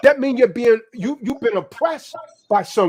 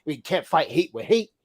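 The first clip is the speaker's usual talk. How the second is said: lower, softer whiny mock voice; mimicking a timid peace talker; 7 semitones lower, 7 dB quieter than usual. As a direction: parody the peace preacher, low and meek. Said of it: "with someone like him".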